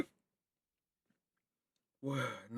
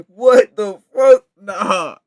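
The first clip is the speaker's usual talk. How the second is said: usual speaking voice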